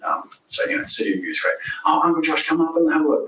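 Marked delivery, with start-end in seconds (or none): 1.8-3.3 lightly voiced little boy, higher; a hint of an eager kid calling his uncle up; child's voice. Son coaxing uncle upstairs, lightly childlike.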